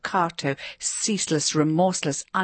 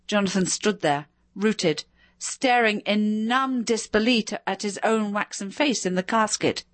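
usual speaking voice

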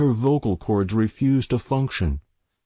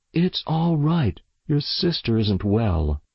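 plain reading voice

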